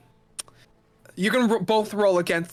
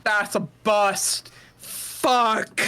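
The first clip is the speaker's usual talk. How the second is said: gruff stranger; much slower than usual. Acted. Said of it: "they go".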